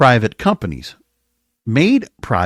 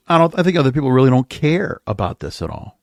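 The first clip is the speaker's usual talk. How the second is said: ordinary talk, nothing marked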